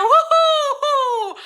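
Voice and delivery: high, drawn-out woo-hoo; real excited cheer; excited whoop. Real, not acted. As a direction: whoop it up, thrilled. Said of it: "Are you as excited as I am?".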